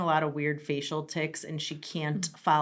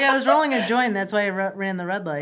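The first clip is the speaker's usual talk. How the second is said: high, loud, laughing through it; mock the driver while laughing; laughing mock quote; 5 semitones higher, 8 dB louder than usual. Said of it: "She's like".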